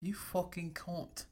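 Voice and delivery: soft, slow mock-British accent. Shown as a demonstration. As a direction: attempt a British accent, hushed and drawn out. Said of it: "I'm saying in a British accent".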